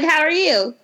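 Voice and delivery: ordinary delivery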